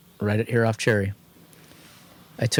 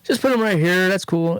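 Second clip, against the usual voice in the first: much higher, breezy imitation of the shop guy; casual shop owner; 11 semitones higher than usual. Shop guy telling him where to put the wheels, breezy and high. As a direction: lightly mimic his easygoing tone. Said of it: "But he's like".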